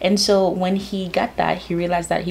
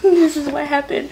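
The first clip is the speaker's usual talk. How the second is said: laughing; 8 semitones higher, 4 dB louder than usual. Real, not acted. Laughing at tear-marked book.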